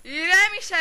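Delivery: high, loud calm-down command; shouted order. Acted. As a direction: shout over the struggle.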